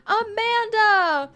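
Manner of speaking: high, loud call stretched long; yelling across the yard. Acted. Dad yelling across the yard to his daughter, high and loud. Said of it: "I yell across the yard to my daughter".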